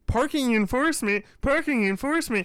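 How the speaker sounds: high, prissy parking officer voice; mock a petty meter maid, smug.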